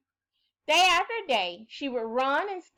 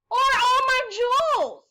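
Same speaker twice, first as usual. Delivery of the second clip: eager princess offer; 13 semitones higher, 7 dB louder than usual. Acted. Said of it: "she offered".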